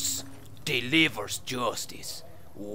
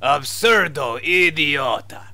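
put-on loud Spanish-accented insult; fake Spanish accent; 6 dB louder than usual. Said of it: "your fake Spanish accent".

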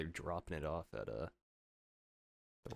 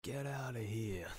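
put-on high, drawn-out New Yorker accent; movie New Yorker; 6 semitones higher, slower than usual. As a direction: do a classic New York movie accent, slowly. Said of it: "classic, uh, New Yorker in a movie style".

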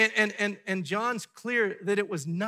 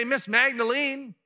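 slow, high and loud "Miss Magdalene"; a formal, distant way to call her; stiff formal address; 7 semitones higher, 6 dB louder than usual. Pretend. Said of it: "He didn't say".

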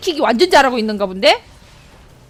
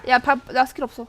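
ordinary talk

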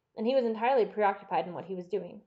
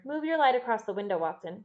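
plain reading voice

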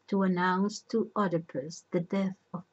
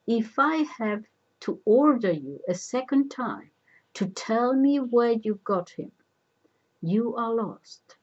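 higher, louder, commanding threat; threatening order; 5 semitones higher, 5 dB louder than usual. A narrator's reading. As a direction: warn him with a raised voice. Oedipus threatens the old man.

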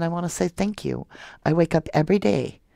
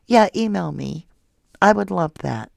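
ordinary talk, nothing marked

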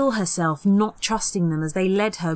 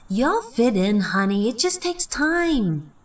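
reassuring mother; 5 semitones higher than usual. Acted. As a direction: soothe the daughter. Mum voiced reassuring.

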